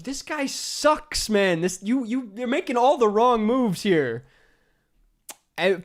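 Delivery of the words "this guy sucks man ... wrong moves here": exasperated. Retold or imitated. Inner exasperated commentary.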